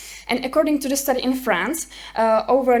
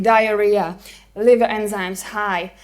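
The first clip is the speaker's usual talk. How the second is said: normal talking voice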